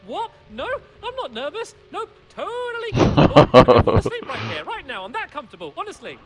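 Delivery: forced nervous laugh, loud; overly loud and jittery, protesting; nervous denial. Acted. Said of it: "you seem nervous".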